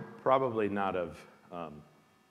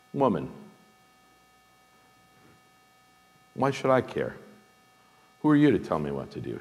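curt, sharp Jesus rebuking his mother; sharp rebuke; 7 semitones higher, 7 dB louder, faster than usual. Retold or imitated. Jesus rebuking his mother at Cana, sharply. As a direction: snap it like a brusque rebuke. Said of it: "I put that sharply".

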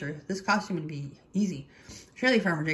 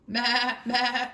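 higher, bleated baas; bleating sheep; 5 semitones higher, 3 dB louder than usual. A narrator's reading. Sheep greeting Turkey in a pig costume, bleating. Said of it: "bleated Sheep".

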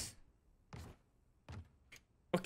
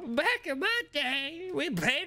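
grandma voice; 9 semitones higher than usual. Retold or imitated. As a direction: creak it out like a grumpy grandma. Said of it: "Sounded like a grandma".

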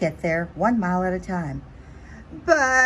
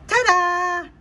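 high, loud triumphant flourish; proud ta-da; 11 semitones higher, 5 dB louder than usual. Acted. Butterfly showing off her emergence, high proud ta-da. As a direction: announce yourself like a magic trick.

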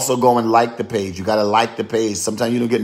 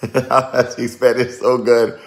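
slow, laughing repeat of the compliment; laughing through the words, tickled; 3 semitones higher, 4 dB louder, much slower than usual. Real, not acted. Repeating a viewer's praise of her Spanish, laughing slowly.

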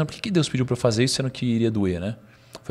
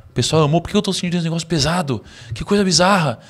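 retold bewilderment, pitched up; puzzled, raised-voice disbelief; baffled self-questioning; 6 semitones higher, 4 dB louder than usual. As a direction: wonder aloud, higher and insistent. Retold puzzled thought after the talk.

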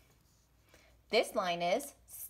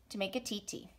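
normal talking voice

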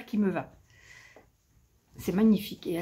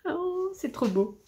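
excited; 9 semitones higher, 3 dB quieter than usual. Real, not acted. Sees the ornament.

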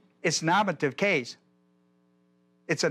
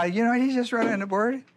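higher-pitched first-grader tattling; a hint of a young grandkid piping up; lightly voiced grandchild; 6 semitones higher than usual. Grandkid telling on grandpa at the board, lightly mimicked child. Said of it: "my grandkids may be watching".

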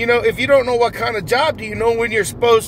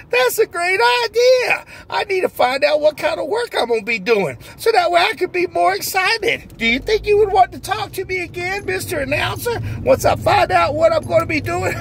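overjoyed new hire; 6 semitones higher than usual. Acted. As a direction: squeal it out like the happiest man alive. Character answers the interviewer.

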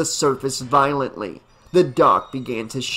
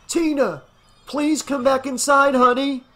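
high-pitched plea called out; pleading call; 11 semitones higher than usual. A narrator's reading. Father calling his daughter back from the lake, pleading call.

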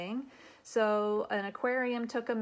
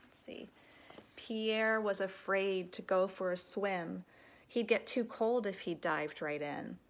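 usual speaking voice

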